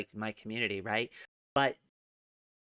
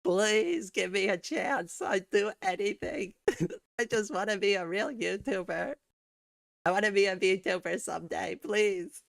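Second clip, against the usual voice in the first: pleading; 6 semitones higher than usual. Pretend. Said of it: "There's my subscription beg".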